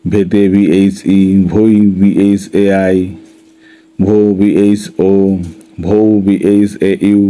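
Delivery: slow, measured syllable-by-syllable recitation; rhythmic spelling drill. Shown as a demonstration. Teacher leading students to keep time, rhythmic spelling drill. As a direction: spell it out slowly for repeating along. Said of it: "তুমি আমার সাথে সাথে তাল রেখে".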